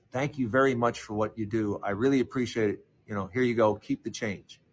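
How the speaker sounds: put-on grateful old man. Old customer thanking the paperboy at the door, soft and low.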